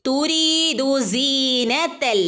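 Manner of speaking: തുരീദു with the long ree held for two counts; lengthened vowel. Shown as a demonstration. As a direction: chant തുരീദു slowly, stretching the ree. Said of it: "രണ്ടനക്കം നീട്ടി കൊടുക്കുക". (തുരീദു രണ്ടനക്കം നീട്ടി)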